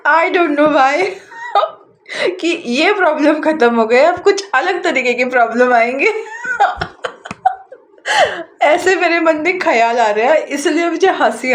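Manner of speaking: talking through a fit of giggles; real giggling. Real, not acted. Tarot reader amused by her own prediction of new problems, giggling. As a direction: laugh helplessly between and through the words. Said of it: "मुझे हंसी आ रही है".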